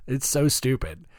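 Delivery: ordinary talk, nothing marked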